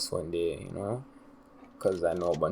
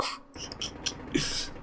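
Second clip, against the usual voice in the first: breathy laugh; 7 dB quieter than usual. Real, not acted. Laughs at his own mistake.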